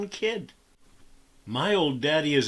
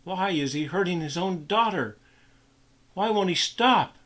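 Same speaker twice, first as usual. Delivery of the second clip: put-on higher, horrified boy; inner scream; 7 semitones higher than usual. A narrator's reading. Boy watching the beating, horrified inner cry. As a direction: cry out inside in horror. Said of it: "Steve screamed inside his own head".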